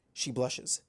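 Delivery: ordinary delivery, nothing marked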